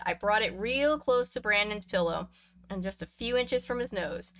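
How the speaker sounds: ordinary delivery, nothing marked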